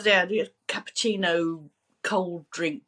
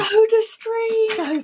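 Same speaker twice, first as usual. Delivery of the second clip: excited; 5 dB louder, much slower than usual. Real, not acted. Excited recall of old product.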